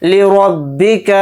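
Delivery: लिरब्बिक with a thick, rounded रा; heavy ra. Shown as a demonstration. Teacher demonstrating heavy रा, careful. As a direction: make the रा in लिरब्बिक full and heavy. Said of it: "रा को यहाँ पर मोटा पढ़ेंगे".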